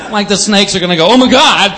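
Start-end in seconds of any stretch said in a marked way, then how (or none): 1.1-1.7 high, loud mock alarm; raised-pitch pretend fright; mock panic. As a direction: play a comically startled reaction. Snakes' imagined panicked reaction.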